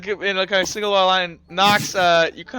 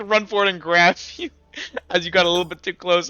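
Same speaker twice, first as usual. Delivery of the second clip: ordinary delivery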